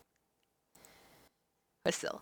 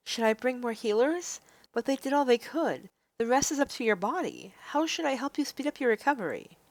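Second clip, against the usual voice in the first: put-on pitched up, concerned young woman; raised girlish heroine voice, worried; earnest heroine voice; 3 semitones higher, 3 dB quieter than usual. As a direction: play the heroine, eager to help. Voicing the heroine Rem.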